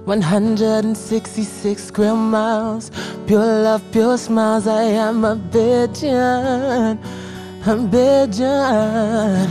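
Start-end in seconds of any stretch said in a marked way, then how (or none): none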